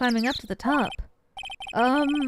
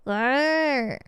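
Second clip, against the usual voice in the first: one rolled growl; tiger growl. Acted. Tiger answers the command to stay, growling.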